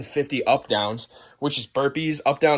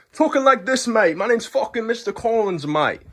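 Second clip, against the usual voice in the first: hammed-up Australian teacher introducing himself; Australian gym teacher; 7 semitones higher than usual. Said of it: "He was Australian".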